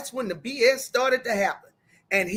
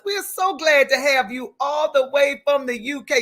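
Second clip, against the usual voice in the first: ordinary talk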